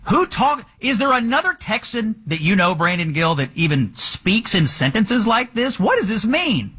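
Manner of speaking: high-pitched, baffled rhetorical questioning; incredulous. Real, not acted. Host asks co-guest who talks like this, incredulous. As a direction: pitch it up in disbelief at the quote. Host mocking the candidate's quoted words.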